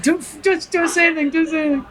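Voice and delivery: high, breathless fan begging; starstruck fangirl plea. Retold or imitated. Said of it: "just going".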